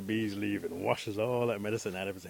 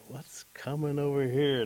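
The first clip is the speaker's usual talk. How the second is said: alarmed; 5 semitones higher, 6 dB louder than usual. Acted. Parents see him approaching.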